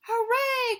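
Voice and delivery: high, loud hooray, breath heavy; joyful cheer. Acted. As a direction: cheer with relief. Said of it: "cried Frog".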